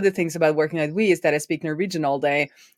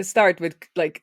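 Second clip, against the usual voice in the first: ordinary talk, nothing marked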